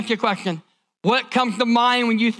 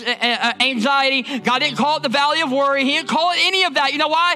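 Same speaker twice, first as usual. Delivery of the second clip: driving repetition climbing higher to a peak; building preaching refrain; 4 semitones higher than usual. Acted. Preacher telling the congregation why the valley was named, building fervor. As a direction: build each phrase toward a ringing climax.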